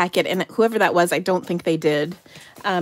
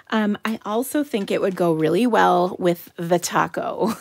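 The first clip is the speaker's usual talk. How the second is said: ordinary talk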